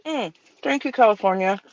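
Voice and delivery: talking around a paintbrush; mouth full. Real, not acted. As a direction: brush held between the teeth. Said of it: "Sorry, I have a brush in my mouth".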